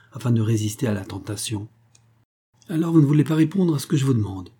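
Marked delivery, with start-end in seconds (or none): 2.7-4.5 fast, impatient demand, a little higher and louder. A narrator's reading. Grandson presses the old woman impatiently, fast and pushing. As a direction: hurry the question, losing patience. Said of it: "dit-il avec impatience".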